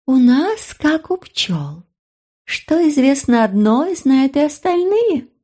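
high, slow little fairy voice; a toothy, pleased little fairy. Acted. Fairy speaking, voiced by narrator.

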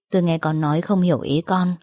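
usual speaking voice

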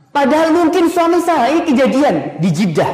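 high, loud sermon voice; fervent preacher, high and loud; preaching at full force. Acted. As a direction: drive the point home to the congregation. Preacher raising voice.

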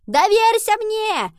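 very high, loud, stretched-out yell; urgent shout. A narrator's reading. Henry shouts back to doubting Nova as they run. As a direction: call it back at full voice, slowly.

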